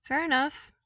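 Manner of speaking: put-on lighter, upbeat young voice; bright eager invitation; cheerful Luke. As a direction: friendly call to the troopers.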